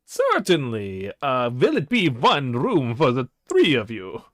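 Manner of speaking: a hint of a creepy Transylvanian host; lightly voiced vampire innkeeper; vampire innkeeper accent. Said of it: "you have an accent".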